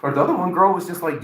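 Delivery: ordinary talk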